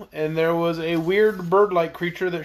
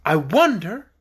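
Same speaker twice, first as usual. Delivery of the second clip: ordinary delivery, nothing marked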